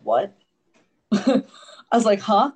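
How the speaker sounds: high, loud, laughing disbelief at the news; a startled, laughing double take; stunned disbelief. Retold or imitated. Told patients must give up dog.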